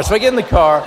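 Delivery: normal talking voice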